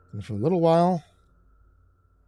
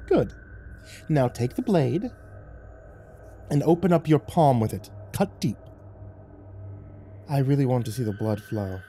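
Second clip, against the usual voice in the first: higher, measured, gloating orders; sinister devil cat; 3 semitones higher than usual. Acted. Lucifer ordering a blood offering, gloating and measured. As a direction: savor each cruel instruction.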